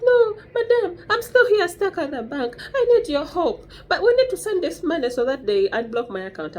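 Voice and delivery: high, squeaky put-on voice with a laugh; mock the pleading scam caller in a high voice; mocking the scammer. Caller's voice mocked in retold call.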